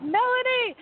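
high, excited call of her name; retold fan scream. Fan calling to [name] at her van, high mock scream. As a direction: shout her name across the street. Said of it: "I screamed at her".

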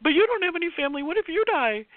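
high-pitched, hurried mock panic; retold alarm. Worried astrologer retelling her question about family, high mock panic.

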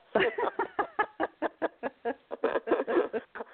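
delighted extended laugh; long high laugh. Real, not acted. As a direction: let the laugh run on high. Long laugh after joking.

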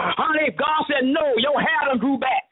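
high, driving sermon delivery; full-force preaching. Real, not acted. Preacher delivering God's promise of blessing, fervent preaching.

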